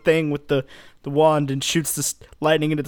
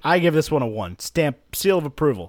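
ordinary talk, nothing marked

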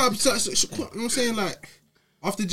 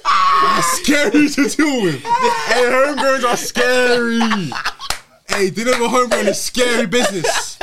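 loud and high, laughing while shouting; 7 semitones higher, 6 dB louder, slower than usual. Real, not acted. Laughing outburst about homegrowns.

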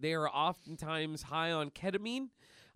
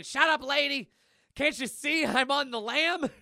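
high, loud cartoon yell; hammed-up shouted retort; screaming; 9 semitones higher, 7 dB louder than usual. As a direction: scream it back in exasperation. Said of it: "And Milk is screaming".

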